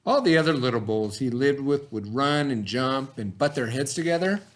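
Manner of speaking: put-on lively picture-book narration for young listeners; reading to children. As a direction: tell it like bedtime for a class of kids. Reads picture book to kids.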